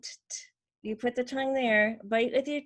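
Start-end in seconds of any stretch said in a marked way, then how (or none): 0.0-0.4 short voiceless taps on "t-, t-"; T sound. Shown as a demonstration. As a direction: say "t-, t-" as bare tongue-tip bursts. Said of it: "like where you make a T sound".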